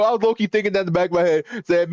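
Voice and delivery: ordinary delivery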